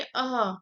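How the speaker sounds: अह as a throat visarga; visarga demonstrated. Shown as a demonstration. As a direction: say अह with the breath released after the vowel. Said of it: "विसर्ग में अगर बोलेंगे".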